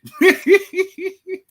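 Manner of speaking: loud high-pitched laughter; a real giggling laugh. Real, not acted. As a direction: burst out laughing. Laughs after mock irritation.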